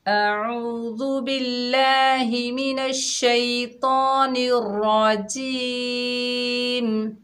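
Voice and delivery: slow, high, drawn-out chanting recitation; Quranic recitation. Acted. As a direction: chant it slowly like tilawat. Recites taawwuz and tasmiyah.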